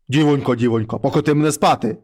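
low, heavy voice of a wolf head at the door; low monster head voice. Acted. Wolf head knocks and calls the girl, low and heavy. Mare head asks for bed.

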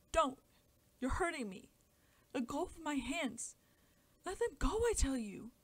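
loud, high shrieking protest; screaming child. A narrator's reading. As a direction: scream to be let go. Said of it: "the child let out a scream".

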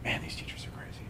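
hushed, voiceless aside about the teachers; whispered grumble. Retold or imitated. Student at a retreat, whispering a grumble. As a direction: whisper it like a secret complaint.

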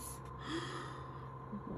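long airy gasp of wonder; amazed gasp. Real, not acted. Amazed by what she unwraps.

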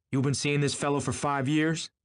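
high, disbelieving sharp question. A narrator's reading. Tom turning on his wife about Gatsby, sharp. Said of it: "Tom turned to Daisy sharply".

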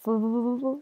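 startled intake of breath; sharp gasp. Acted. Mouse suddenly meets the monster, shocked gasp.

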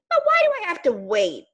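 high, quick, impatient caterpillar; impatient cry. Acted. Caterpillar protesting to ladybug, high and fast. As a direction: plead to know why, rushing. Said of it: "cried Caterpillar".

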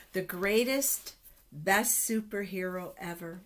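usual speaking voice

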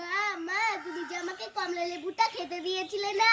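ordinary delivery

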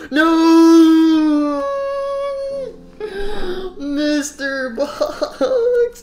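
high, drawn-out mock sobbing wail; hammed-up wailing grief. Mourning lost Mister Box, melodramatic crying. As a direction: wail and gasp in exaggerated mourning.

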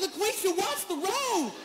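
high, mock-panicked "watch the road"; panicked plea. Terrified passenger begging the driver, high mock panic. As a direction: beg the driver, squeaking with fear. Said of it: "I'm like".

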